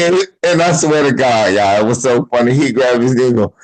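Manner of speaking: ordinary talk, nothing marked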